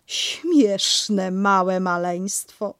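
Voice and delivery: put-on cooing fondly at a silly baby; good-naturedly. Kanga to the baby, very slow and kindly. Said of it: "rzekła Kangurzyca dobrotliwie".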